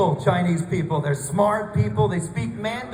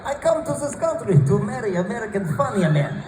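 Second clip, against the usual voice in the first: mock broken-English Russian bride, laughing; lightly voiced Russian mail-order bride; Russian accent; 3 semitones higher than usual. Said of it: "it wasn't like".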